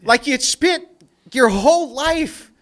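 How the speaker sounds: loud, high, exasperated rant; a man ranting about Dawkins's hypocrisy, loud and exasperated; heated rant. Real, not acted. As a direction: rail against him in disbelief.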